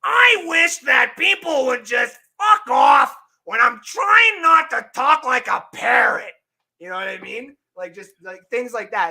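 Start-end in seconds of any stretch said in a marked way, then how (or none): 0.0-6.3 high, slow, loud squawking shout; high, slow shouted comedian impression; Gilbert Gottfried impression. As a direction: yell it like a cranky comic, dragging each word. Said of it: "say, like, Gilbert Gottfried and be like".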